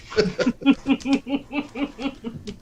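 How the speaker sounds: long, high-pitched laugh; real high chuckling fit; high giggling laugh. Real, not acted. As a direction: crack up at the silly accent. Laughing at their mock Boston accents.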